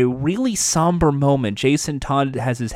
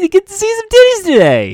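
hammed-up hype; 8 dB louder, faster than usual. Hammed-up porn actor hype.

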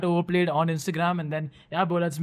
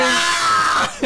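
mock shout; 9 semitones higher, 4 dB louder than usual. Joking about plane overhead.

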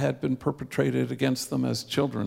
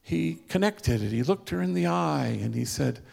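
ordinary talk, nothing marked

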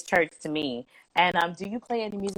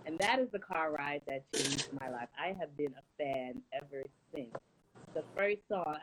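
normal talking voice

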